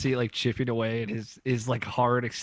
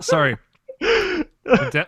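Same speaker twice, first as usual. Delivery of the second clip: coughing laugh, high-pitched; choking laugh; 6 dB louder, much slower than usual. Real, not acted. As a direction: sputter after swallowing wrong. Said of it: "went down the wrong pipe".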